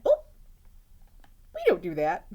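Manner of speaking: usual speaking voice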